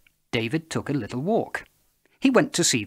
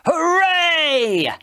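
high, much louder cheer; joyful shout; 8 dB louder than usual. A narrator's reading. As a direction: cheer the victory. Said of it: "shouted a big".

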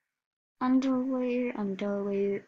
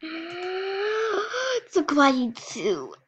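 high, slow gasping gush; gasping squeal; 7 semitones higher, much slower than usual. Real, not acted. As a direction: gasp then squeal it. Gasp and gush at cute item.

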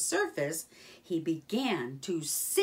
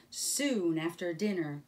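ordinary delivery, nothing marked

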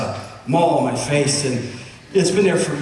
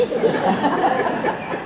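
laugh; 10 semitones higher than usual. Real, not acted. Laughs at the price.

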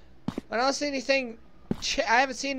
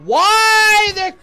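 startled outburst; 6 semitones higher, 8 dB louder than usual. Real, not acted. Startled by sudden noise.

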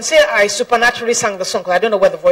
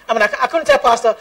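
normal talking voice

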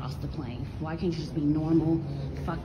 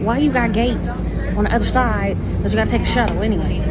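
loud, higher, fed-up rant at the airline; annoyed rant; 5 semitones higher, 10 dB louder than usual. Real, not acted. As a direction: vent the anger at Delta. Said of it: "it's really getting annoying".

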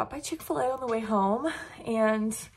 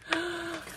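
excited gasp; 8 semitones higher, 6 dB quieter than usual. Real, not acted. Gasp over gift.

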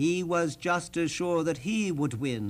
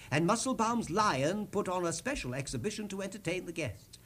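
ordinary delivery

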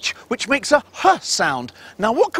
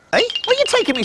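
normal talking voice

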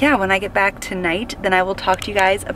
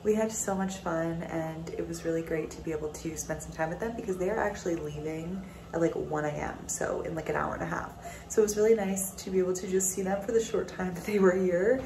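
much softer, worn-out late-night voice; tired and quiet; 3 semitones higher, 6 dB quieter than usual. Real, not acted. Exhausted vlogger talking at night after a long day, quiet. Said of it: "I am exhausted".

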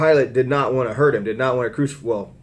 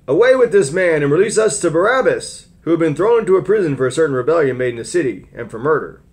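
higher, louder mob demand; crowd crying out; 6 semitones higher, 6 dB louder, faster than usual. Acted. Preacher voicing the crowd demanding Barabbas, loud. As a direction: call it out like the crowd. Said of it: "they all cried out at once".

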